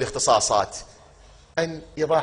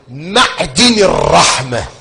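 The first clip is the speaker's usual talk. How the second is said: declaimed phrase; 2 semitones higher, 12 dB louder, much slower than usual. Acted.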